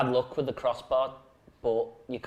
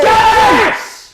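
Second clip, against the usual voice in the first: high, loud triumphant shout; real yell of joy; goal celebration yell; 15 dB louder than usual. Real, not acted. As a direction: roar it as the ball goes in.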